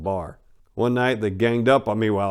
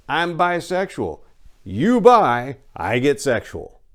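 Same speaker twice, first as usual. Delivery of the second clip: quoting Jeff's pun; 5 semitones higher, 5 dB louder, slower than usual. Retold or imitated. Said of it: "his explanation ran along the lines of".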